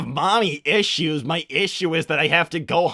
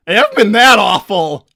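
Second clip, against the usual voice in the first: loud, raised-pitch cry of protest; indignant protest; 6 semitones higher, 13 dB louder than usual. Acted. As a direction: shout in your own defence. Protesting an accusation from chat.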